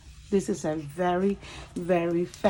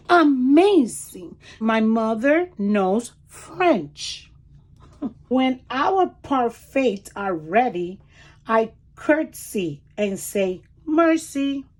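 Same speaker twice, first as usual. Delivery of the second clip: lively storybook read-aloud; reading to children; 6 semitones higher, 8 dB louder, much slower than usual. Acted. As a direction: read it like a picture book for small kids. Reads picture book aloud.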